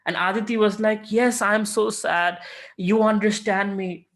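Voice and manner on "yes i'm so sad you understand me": higher, slow, a touch of sorrowful relief; mock sad relief. Aditi telling the Lord she feels understood, slow and higher. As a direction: lean into being understood, lightly.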